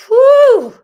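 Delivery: piercing "whoo" that drops off; loud high whoop. Real, not acted. Host taking a few minutes, whooping loudly. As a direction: let out a big high whoop.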